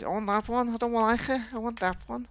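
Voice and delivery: high, whiny brat voice, far from own; mock childish tantrum. Player mocking his own pickiness over car parts, childish tantrum. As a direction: whine it like a spoilt kid demanding.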